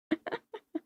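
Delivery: high-pitched breathy giggle she apologizes for; embarrassed laugh. Real, not acted. Streamer laughing at herself on stream, high giggle. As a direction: let out a high giggle that you find embarrassing. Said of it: "such a horrible noise I make".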